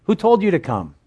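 a high, pressed "Who told you to come?" with a hit on "told"; indignant rebuke. Retold or imitated. The preacher voicing God scolding uninvited worshippers, indignant. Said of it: "God's like saying".

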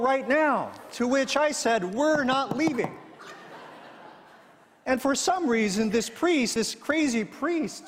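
boastful villager. Retold or imitated. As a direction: crow about the win like a simple villager. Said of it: "the humble villager reports of his victory and says".

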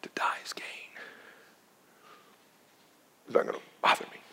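soft hushed whisper of Paul's calm answer. Acted. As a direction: breathe it out as a secret.

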